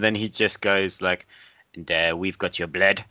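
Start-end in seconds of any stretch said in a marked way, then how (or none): 1.8-3.0 put-on a light French Canadian accent, deadpan. Retelling the French Canadian agent's line in French Canadian accent. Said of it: "this French Canadian guy".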